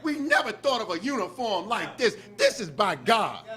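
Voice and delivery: loud, driving sermon voice; preaching. Acted. Minister preaching to the mosque about Farrakhan, loud and rousing. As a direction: build the congregation up, hammering each line.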